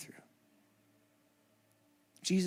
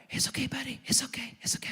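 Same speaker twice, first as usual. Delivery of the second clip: soothing whisper; 10 semitones higher, faster than usual. Retold or imitated. Dad calming his crying son, whispering. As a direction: hush him gently, like calming a hurt child.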